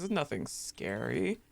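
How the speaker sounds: normal talking voice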